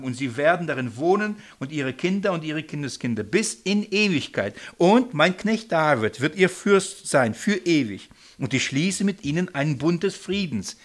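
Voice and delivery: a loud, solemn Bible reading; scripture reading. Acted. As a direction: proclaim the verse to the room. Reads scripture aloud.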